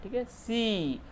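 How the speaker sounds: usual speaking voice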